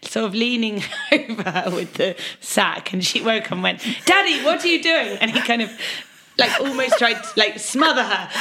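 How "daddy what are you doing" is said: put-on high, startled little girl; startled child voice. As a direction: squeak it like a woken kid. Niece wakes, retold story.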